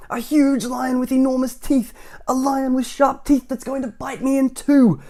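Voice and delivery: very high, loud escalating wail; hammed-up panic. Mister Jelly imagining a lion, wailing in escalating panic.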